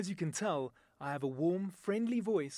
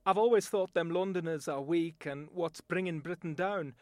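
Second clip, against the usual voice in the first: blunt Northern English accent; a proud Northerner scorning Londoners. Shown as a demonstration. A Northerner boasting about hard work, in a Northern English accent. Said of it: "my voice talent demonstration".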